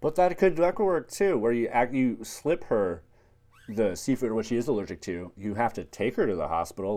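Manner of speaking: ordinary delivery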